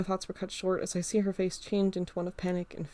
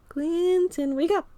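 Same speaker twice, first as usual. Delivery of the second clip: Carl's higher voice calling out louder; a friend calling louder to wake him; 10 semitones higher, 5 dB louder, slower than usual. Acted. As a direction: raise the voice to rouse a sleeper. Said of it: "Carl says, pushing on my side".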